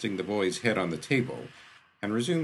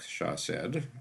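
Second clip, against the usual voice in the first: ordinary delivery, nothing marked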